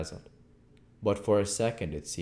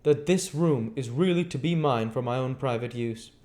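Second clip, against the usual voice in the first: higher, curt stranger; stranger's voice; 8 semitones higher, 6 dB louder than usual. Acted. Stranger answering Henfrey, higher curt voice. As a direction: play the aloof stranger, pitched up.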